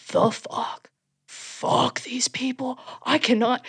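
hissed under her breath, slow; seething whisper from the back row; slow, unvoiced furious whisper at the judges.

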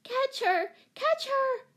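put-on very high child's shriek. A narrator's reading. Small Betsy urging everyone after the cat, shrieking. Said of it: "shrieked Betsy".

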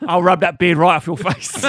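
loud laughing cockney; slow cockney threat through laughs; cockney accent. Retold or imitated. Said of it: "cockney as fuck".

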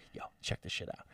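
hushed, secretive whisper; sneaky retold whisper. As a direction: whisper it like hiding a bag. Dad sneaking hair gel in.

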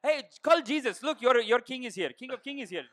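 put-on high Persian accent, laughing. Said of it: "I do Persian one all day".

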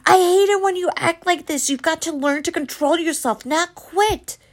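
high, scolding shout; angry scolding. A narrator's reading. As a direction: scold her, furious and fed up. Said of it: "Mom screamed over the thunder".

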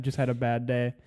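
ordinary talk, nothing marked

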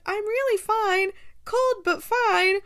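high, loud, brave little voice; soaked sister reassuring everyone; lightly voiced Edwina. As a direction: shiver it off bravely.

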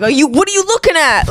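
high, confrontational New York challenge; acted-out prickly New Yorker; New York attitude. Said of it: "actual New York accent".

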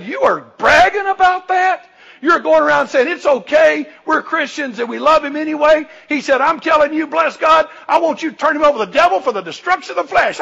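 high, loud, outraged preaching as Paul; Paul scolding believers who brag about tolerating sin, loud and outraged; indignant rebuke. Retold or imitated. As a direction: thunder it at the proud church. Paul rebukes the boasting church.

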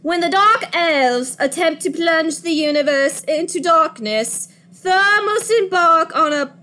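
high, theatrical British narrator; put-on British accent. Podcaster reading the Thor synopsis to co-hosts, mock British accent. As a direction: read it in a grand, hammed-up British accent. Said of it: "say it in a British accent".

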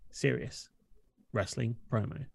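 hushed mock-dramatic whisper. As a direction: breathe the words, dead serious. Mock-solemn promo build-up.

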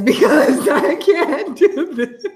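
helpless laughing admission. Real, not acted. Woman admitting she can't do pull-ups, laughing. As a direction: break up laughing mid-sentence.

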